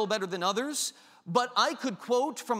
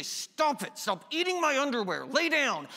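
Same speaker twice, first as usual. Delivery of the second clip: higher, exasperated scolding; scolding the dog; 5 semitones higher than usual. Retold or imitated. Owner scolding a dog for eating underwear, high and exasperated. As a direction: tell off the dog chewing underwear. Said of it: "your dog does when you say".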